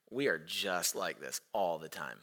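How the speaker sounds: low, soft dawning awareness; hushed realization. Retold or imitated. Preacher recalling his realization amid the tantrum, hushed and low. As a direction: drop down low and quiet, as if to yourself.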